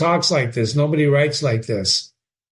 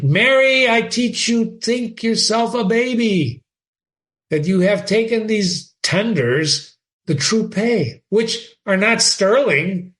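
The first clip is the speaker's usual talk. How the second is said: put-on high, slow, emphatic old father; lecturing father; 7 semitones higher than usual. Polonius warning Ophelia, emphatic high father. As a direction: drive each warning home.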